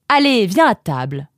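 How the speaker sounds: lively summons to eat; cheerful motherly command. Acted. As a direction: order it briskly as a mother. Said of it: "commanda sa maman".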